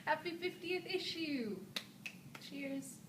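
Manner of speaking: a playful put-on British accent to finish. Greeting the blog's fiftieth issue in a mock British accent. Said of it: "in the British accent".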